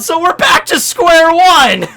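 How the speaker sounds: slow, loud, high-pitched exclaim; excited exclaim. Real, not acted. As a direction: drag it out loudly, thrilled. Laughing excited theory rant.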